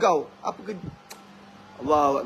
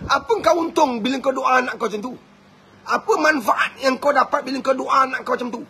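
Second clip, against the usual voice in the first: ordinary talk, nothing marked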